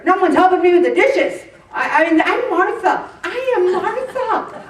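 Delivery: a harried hostess venting; frazzled Martha. Retold or imitated. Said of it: "Martha was out there".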